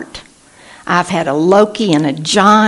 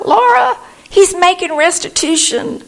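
high, louder thrilled call; excited; 11 semitones higher, 4 dB louder than usual. Retold or imitated. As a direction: squeal her name with glee.

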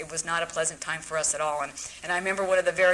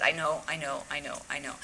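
soft, softer repeated reassurance; soothing counselor; 4 semitones lower, 6 dB quieter than usual. Retold or imitated. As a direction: lull her with each I know.

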